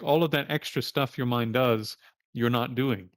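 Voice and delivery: ordinary talk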